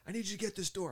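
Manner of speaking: high, loud retold command; urgent order. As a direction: imitate a boss rushing someone to a job. Matt gives urgent order.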